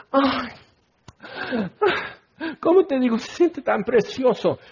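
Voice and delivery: lightly mocking, high-pitched swoon; blissful swooning. Preacher mimicking believers who feel it is so precious, blissful swooning.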